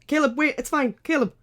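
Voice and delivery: high, loud in-character shout; urgent shout. Acted. Stepping in front to calm the wolf, urgent shout. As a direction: call out high and urgent to stop someone. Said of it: "go and shout".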